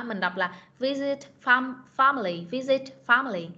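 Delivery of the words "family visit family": normal talking voice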